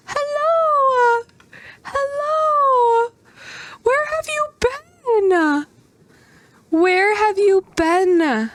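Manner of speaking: high cutesy pet talk. Real, not acted. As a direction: coo at a long-lost pet. Greeting a lost pet.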